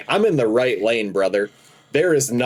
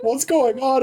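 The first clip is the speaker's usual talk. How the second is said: put-on girlfriend; 13 semitones higher, slower than usual. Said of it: "she's like".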